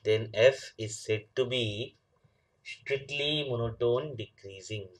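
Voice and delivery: reads the definition slowly, word by word, for note-taking; slow dictation. Shown as a demonstration. Teacher slowly dictating a definition to the class. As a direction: spell it out slowly so students can write.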